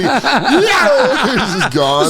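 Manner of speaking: high laughing yelp of a blown-away tenant; laughing as the old man is blown away; laughing mock cry. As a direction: squeal it through laughter.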